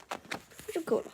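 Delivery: plain reading voice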